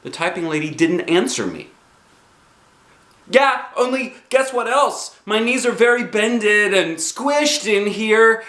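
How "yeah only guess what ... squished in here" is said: put-on high, bouncy kid voice; chirpy child voice, pitched up; little girl Junie B. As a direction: play a bossy six-year-old.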